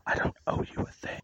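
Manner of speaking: whispery, voiceless quote of a TV line; imitating girl in show. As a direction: quote the show's line under your breath. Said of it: "Like that girl in the show".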